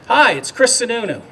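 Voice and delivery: imitating the governor's excited phone greeting. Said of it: "as you hear his excitability".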